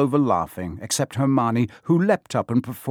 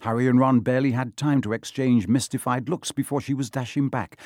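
usual speaking voice

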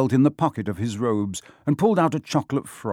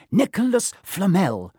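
slow, high stage whisper; dramatic whisper; 9 semitones higher than usual. A narrator's reading. Girl reveals the name to friends, whispering dramatically. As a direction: announce the name slowly, as a grand secret. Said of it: "she whispered dramatically".

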